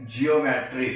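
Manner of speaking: "जियोमेट्री" said jee-yo-me-tree, stress on the first syllable; "जियोमेट्री" spoken as one smooth word, jee-yo-me-tree; model pronunciation. Shown as a demonstration. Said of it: "क्या बोलेंगे?".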